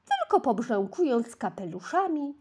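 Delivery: ordinary delivery, nothing marked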